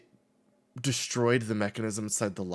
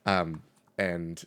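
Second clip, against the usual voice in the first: ordinary delivery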